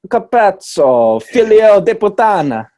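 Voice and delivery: loud, high hammed-up Italian curse; over-the-top Italian street yell; mock Italian outburst.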